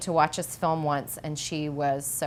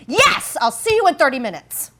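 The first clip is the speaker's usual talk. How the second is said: eager yes; 9 semitones higher, 10 dB louder than usual. Retold or imitated. Actor's eager reply, retold.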